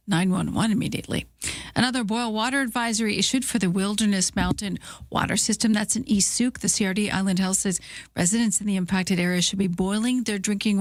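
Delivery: steady, neutral newscaster delivery; news read. Acted. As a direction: read the headlines like a radio newsreader. Reads the news bulletin.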